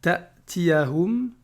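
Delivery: Ta'tiyahum very slow, sound stopped at the hamza; hamza cut short. Shown as a demonstration. Teacher showing the hamza with sukun, cut. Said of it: "le son il doit être coupé".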